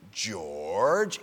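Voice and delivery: dropped low, drawn-out hint; feed the answer in a low voice; low hinting prompt. Retold or imitated. Helper coaxing the little kid with the answer, low prompt.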